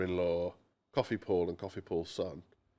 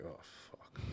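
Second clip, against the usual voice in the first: whispered dismay; 16 dB quieter than usual. Retold or imitated. Brother-in-law reacts in dismay.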